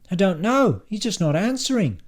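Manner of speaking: high, helpless report; flustered Silvio. Acted. Silvio outside the locked toilet door, flustered and high. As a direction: voice pitched right up, at a loss.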